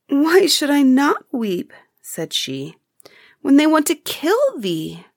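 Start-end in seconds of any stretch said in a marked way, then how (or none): 0.1-1.7 high, slow, grieving cry; tearful lament. Acted. Girl weeps as heifer is doomed. 3.4-5.1 tearful lament. Acted. Girl grieves for the heifer.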